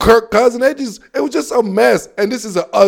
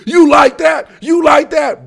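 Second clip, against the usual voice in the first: higher-pitched imitation of a hyped-up quarterback; Kirk Cousins; 7 semitones higher, 3 dB louder than usual. Host imitates quarterback's famous moment, hyped catchphrase. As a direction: mimic an athlete's excited catchphrase. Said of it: "And then you see Kirk Cousins".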